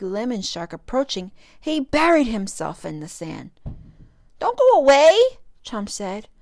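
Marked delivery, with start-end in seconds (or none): 4.4-5.4 high, loud desperate cry; pleading call. Acted. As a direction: beg them to stay. Calling after fleeing stingray.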